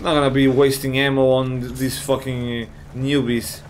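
ordinary delivery

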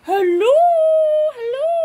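high, rising hello; pretend phone greeting. Unicorn makes a phone call, high calling hello. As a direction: call brightly into a toy phone.

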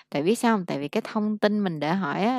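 normal talking voice

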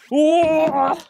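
high loud yelp of fright; startled scream. Real, not acted. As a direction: scream as the bridge lurches. Bridge swaying hard. (깜짝 놀라 높게 지르는 비명)

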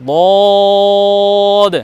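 বদ as heavy Arabic daad, vowel held very long; tongue-side Arabic daad. Shown as a demonstration. Teacher demonstrates a difficult letter, long heavy বদ. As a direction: press tongue side to upper molars and stretch বদ. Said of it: "জিহ্বার সাইডে গোড়ার সঙ্গে লাগিয়ে উচ্চারণ করুন".

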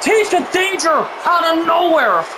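high, shouted play-by-play call; excited commentary. Real, not acted. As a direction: yell the big move. Live wrestling play-by-play.

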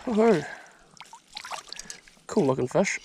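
ordinary delivery

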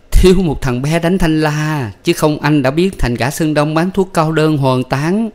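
wife mocking her husband, slow and teasing. A narrator's reading.

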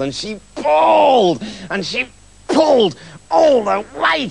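loud, stretched-out heaving; straining effort. A narrator's reading. Narrator acts the witch hauling herself up, straining and drawn out. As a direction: heave on each "pulled" as if hauling.

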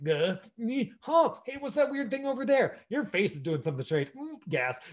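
a hint of a flustered actor losing the line; flustered actor flubbing. Mock flustered actor fumbling on set, lightly voiced. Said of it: "the actors are like".

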